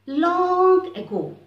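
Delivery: slow, loud, high "Long ago"; fairy-tale opening. A narrator's reading. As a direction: open the tale grandly. Story opening.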